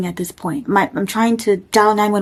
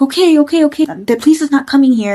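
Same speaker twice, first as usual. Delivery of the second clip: retold scared reassuring; 6 semitones higher, 3 dB louder than usual. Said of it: "And I'm getting scared".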